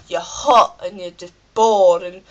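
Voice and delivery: ordinary delivery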